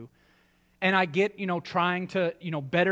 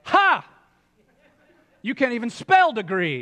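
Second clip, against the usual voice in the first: scoffing loud laugh, much higher; mocking laugh; 7 semitones higher, 9 dB louder than usual. Church member mocking pastor.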